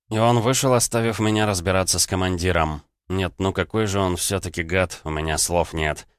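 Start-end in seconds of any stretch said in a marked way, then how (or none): none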